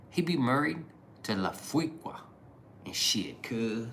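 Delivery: a hint of mocking local street slang; imitating street slang. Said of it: "people were talking like this".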